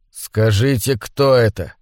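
ordinary delivery, nothing marked

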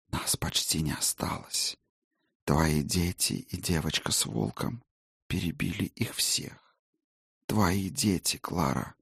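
faint, airy ghost whisper; a weary spirit, barely there; ghostly whisper. Acted. Ghost reports.

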